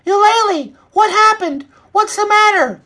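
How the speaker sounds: very high, loud hen voice; high-pitched worried hen; Lola's alarmed voice. A narrator's reading. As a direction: ask urgently in a squeaky high pitch. Hen Lola runs up alarmed.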